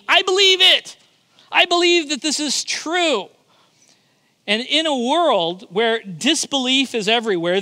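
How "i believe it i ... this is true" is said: preacher voicing the believer's bold creed; bold proclamation. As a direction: proclaim it like a shout. Voicing the confession boldly.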